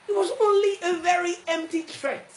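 high, emphatic sermon line; preaching. Acted. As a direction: preach it high and drawn across the phrase.

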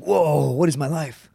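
slow, drawn-out stoned "whoa"; stoned amazement. Retold or imitated. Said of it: "smoke weed and I'll be like".

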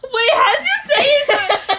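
high, loud laughing question. Real, not acted. Laughing disbelief.

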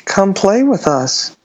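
put-on high, slow, sing-song ghost child; creepy ghost girl. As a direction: lure them in like a haunted little girl. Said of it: "That was like a little girl ghost sing-songy voice".

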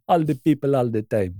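thick mock Jamaican accent; full-on Jamaican accent. Shown as a demonstration. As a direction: commit fully to a Jamaican accent. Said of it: "I'll, I'll go full on".

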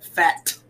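fat with a crisp, hard final T; over-enunciated T in fat. Shown as a demonstration. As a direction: punch the T at the end of fat. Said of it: "Saying your T's a little harder".

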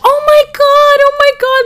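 imitated mom shrieking with excitement; very high, loud, thrilled cry; freaking out. Mom learns of daughter's period, freaking out. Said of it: "They're freaking out".

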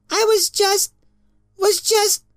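high, caught-out stammer; frightened excuse that trails off; stammering boy. A narrator's reading. Bobby, caught by Mommy Gwen, stammers high and scared.

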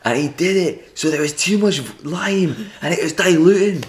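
high, loud Scottish grievance, building; mock an aggrieved Scot telling tales; Scottish accent. Scottish customer complaining to supervisor, high loud Scottish accent. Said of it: "these Scottish fellas came up".

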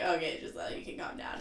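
whispered pep talk to herself; whispering to herself to calm down; self-soothing whisper. Retold or imitated. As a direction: hush yourself down. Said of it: "I was like".

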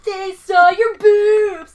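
high, slow, loud teasing; mock him with drawn-out glee; high singsong teasing; teasing husband. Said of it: "and he's like".

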